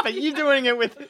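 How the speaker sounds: ordinary talk, nothing marked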